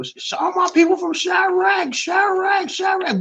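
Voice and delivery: high, loud hype-man shout; host mocks the Houston DJ's hype shout; mocking hype DJ. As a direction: ham up the party DJ yelling to the crowd. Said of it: "every time he referred to Chicago".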